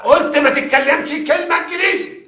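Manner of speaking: high, loud furious scolding; angry yell. Acted. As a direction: yell the order at him. Investigator yells at suspect.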